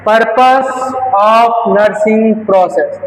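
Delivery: very slow, drawn out; slow topic announcement. Shown as a demonstration. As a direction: spell out the topic slowly. (Topic धीरे-धीरे बोलना)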